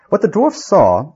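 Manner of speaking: usual speaking voice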